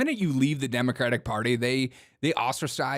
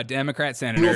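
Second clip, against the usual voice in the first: ordinary talk, nothing marked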